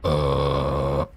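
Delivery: a gravel drone under the words; Karl Urban grunt. Retold or imitated. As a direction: mimic his one-note growl. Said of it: "the voice is him just going".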